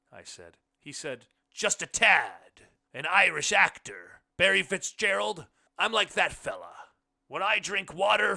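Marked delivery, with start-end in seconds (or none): 1.5-8.4 guest refusing help, loudly. A narrator's reading. Blind man quotes Irish actor.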